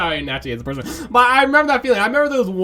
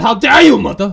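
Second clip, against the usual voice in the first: mock outrage; 3 semitones higher, 9 dB louder than usual.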